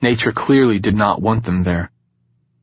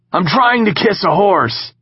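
yelling; 11 semitones higher, 3 dB louder than usual. Acted. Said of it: "Knox yelled at the thunder".

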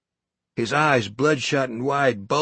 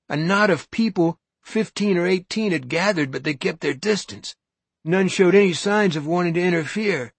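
ordinary delivery

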